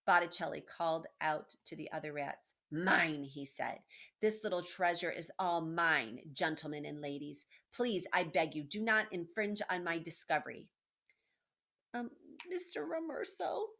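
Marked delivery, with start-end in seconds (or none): 2.7-3.2 possessive claim. Acted. Botticelli claiming his prize. 4.2-10.7 possessive declaration. Acted. Botticelli warning the other rats. 11.9-13.7 timid mouse voice. Acted. Small mouse pleading timidly.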